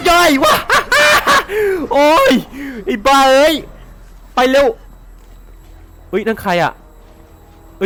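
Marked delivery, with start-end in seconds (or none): 0.1-3.4 panicked laughing yell. Real, not acted. Player caught by zombies, laughing and shouting in panic. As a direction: burst out laughing and yelling at once. Zombie ambush at point blank.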